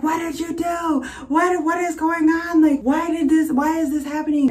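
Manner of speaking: high, drawn-out panicked demand; retold yelling. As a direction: re-enact yelling at a partner, pitch way up. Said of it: "I'm yelling at Edward".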